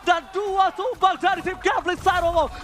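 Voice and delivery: high, loud, driving esports call; loud play-by-play. Real, not acted. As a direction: yell the kills like an announcer. (teriakan komentator)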